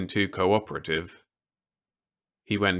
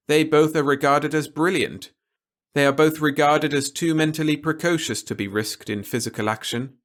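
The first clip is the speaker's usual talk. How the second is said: a lightly raised, measured officer's voice; stern officer; 7 semitones higher, 4 dB louder than usual. A narrator's reading. Officer warning Peter against anything rash, lightly voiced and grave.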